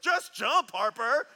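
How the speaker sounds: high, loud mock yell; imitating impatient brother. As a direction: call up to your sister like a little kid. Brother yelling up at his sister.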